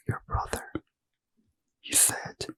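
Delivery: ordinary delivery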